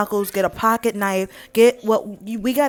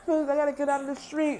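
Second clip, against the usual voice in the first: panicked pleading; 6 semitones higher, 6 dB quieter, faster than usual. Retold or imitated.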